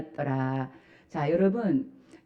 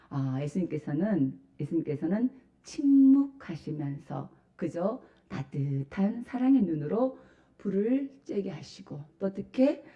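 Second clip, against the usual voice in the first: ordinary delivery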